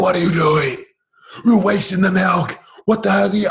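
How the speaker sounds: put-on loud, high yell at the kids, escalating; angry yelling. Father yelling at his kids over spilled milk, angry shout. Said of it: "And I was yelling at them".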